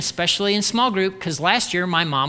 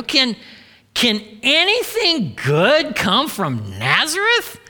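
higher-pitched, slow scornful question; incredulous exclamation; 5 semitones higher, slower than usual. A narrator's reading. Nathanael answering Philip about Nazareth, incredulous exclamation. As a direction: stretch the question out in disbelief. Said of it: "exclaimed Nathanael".

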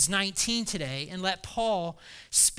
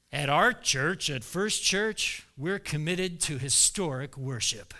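stuffy churchgoer; 5 semitones lower than usual. Retold or imitated. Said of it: "problems that churches have".

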